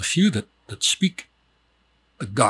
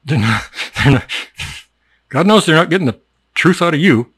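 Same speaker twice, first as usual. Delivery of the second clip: breaks into laughter, much louder; laughing outburst; 3 semitones higher, 7 dB louder than usual. Real, not acted. Comic teacher cracking up at his own joke, laughing. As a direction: laugh it out loud.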